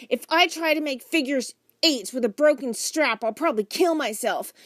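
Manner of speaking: put-on high, loud child's grumble; complaining girl. A narrator's reading. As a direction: butt in with a dramatic complaint. Girl interrupts with her complaint.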